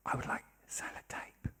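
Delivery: hushed, voiceless whisper; little girl's whisper. Retold or imitated. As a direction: whisper it as a secret in Santa's ear. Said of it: "she whispers in my ear and says".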